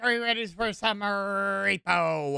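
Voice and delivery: loud, high hammed-up pirate hail, slowing on the last line; hearty mock pirate welcome; pirate captain greeting. Pirate greeting to stream crew.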